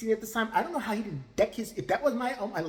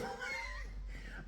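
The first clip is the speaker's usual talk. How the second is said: silent wheezing laugh; 13 dB quieter than usual. Real, not acted. Breaks up at own joke.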